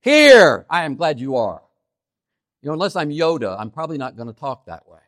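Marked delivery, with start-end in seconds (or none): 0.0-1.6 heavy emphasis on the opening "Here"; fronted HERE stressed. Shown as a demonstration. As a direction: blast out "Here", then settle for the rest. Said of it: "moving words around to do that".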